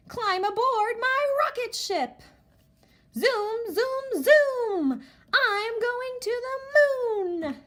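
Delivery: high, lively rocket-ship lines; fun read-aloud. Shown as a demonstration. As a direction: invite them aboard with excitement. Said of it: "use inflection in our voice and make it sound so fun".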